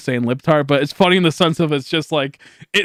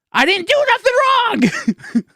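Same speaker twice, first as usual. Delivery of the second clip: very high squeaky whine; mock whiny protest. Mocking the forced apology in a very high whine. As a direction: squeal it like a wronged child.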